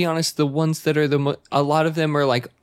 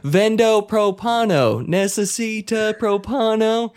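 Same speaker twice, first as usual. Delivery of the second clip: slow, higher sales pitch in gringo Spanish; 7 semitones higher, slower than usual. Acted. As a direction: hawk propane in bad American-accented Spanish. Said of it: "I'm just like".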